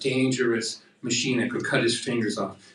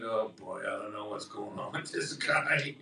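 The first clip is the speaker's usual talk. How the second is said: technician's inner voice; 5 semitones lower, 9 dB quieter than usual. Retold or imitated. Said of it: "she's looking at me like".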